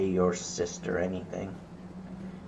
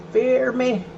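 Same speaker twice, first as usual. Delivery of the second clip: squeaky high villain cry; mad scientist; 13 semitones higher, 4 dB louder than usual. Pretend. Repeated, much higher.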